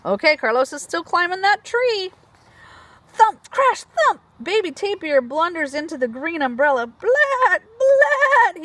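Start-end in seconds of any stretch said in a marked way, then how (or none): 3.1-4.2 high, loud thump and crash; loud, high sound-effect read. A narrator's reading. 6.9-8.6 high, loud bawling blat; a baby tapir's high loud cry. Acted. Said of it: "he bawls".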